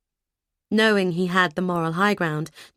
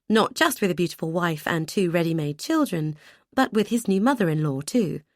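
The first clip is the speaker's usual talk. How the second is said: ordinary delivery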